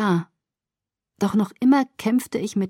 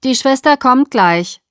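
bored intercom nurse, high, loud and slow; 6 semitones higher, 8 dB louder than usual. A narrator's reading. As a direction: drag it out loudly like a bored receptionist. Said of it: "sagte eine gelangweilte Stimme".

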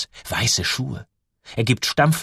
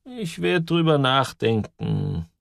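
langsam und gedehnt, nachdenklich; bedächtig; 3 semitones higher, slower than usual. A narrator's reading. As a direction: zieh die Worte überlegend in die Länge. Kringström überlegt zögernd. (bedächtiger alter Musiker, sehr langsam)

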